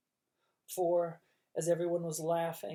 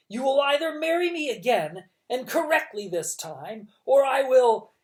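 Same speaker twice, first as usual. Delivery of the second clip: louder, higher threatening villain; menacing villain threat; 8 semitones higher, 11 dB louder than usual. A narrator's reading. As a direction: push the threat harder and harder. Olaf threatens Violet.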